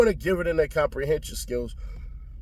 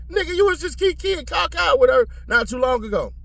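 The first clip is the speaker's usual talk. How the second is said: very high, scoffing comeback; high-pitched mock outrage; incredulous retort; 11 semitones higher than usual. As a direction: fire back in disbelief, voice pitched way up. Retorts to the quoted witness.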